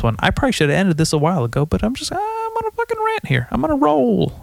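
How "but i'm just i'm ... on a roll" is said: squeaky mock-excited voice, much higher; hammed-up rant. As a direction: overplay how wild your rant is, pitched way up. Playfully overblown about his rant.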